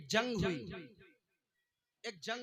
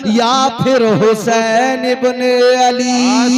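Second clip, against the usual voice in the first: preaching shout; 5 dB louder, slower than usual. Acted. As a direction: stretch each word, loud. Climax verse, crowd cheers after.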